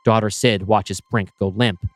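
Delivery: ordinary delivery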